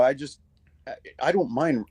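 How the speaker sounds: normal talking voice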